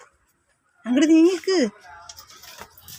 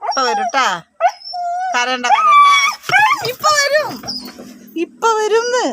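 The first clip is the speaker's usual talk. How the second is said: much higher soothing pet talk; 13 semitones higher than usual. Real, not acted. Comforting the whimpering pet in high baby talk. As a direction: coo to the crying pet, high and sweet.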